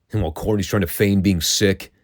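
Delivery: ordinary talk, nothing marked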